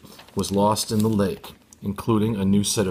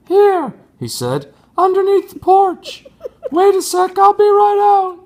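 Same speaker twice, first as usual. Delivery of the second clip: squeaky voice, much higher and louder; 10 dB louder than usual. Acted. Tony calls to Ron from under the porch, very high and loud. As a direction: play him as a silly high-voiced kid. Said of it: "about an octave higher than an owl's".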